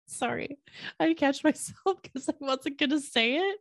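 giggling high through an embarrassed apology; laughing. Real, not acted. Host catching her own slip to listeners, giggling. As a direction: laugh it off after nearly saying it.

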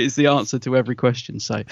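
usual speaking voice

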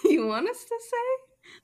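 high, slow puzzled question; confused. Acted. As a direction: ask it baffled and rising.

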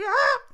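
imitated high, loud yelp; mock shriek of panic; short, sharp "ah!"; startled scream. Acts out Omar's startled cry.

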